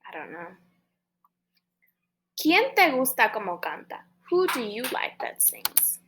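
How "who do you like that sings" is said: usual speaking voice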